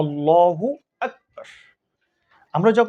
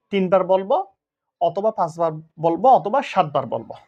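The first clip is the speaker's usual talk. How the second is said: normal talking voice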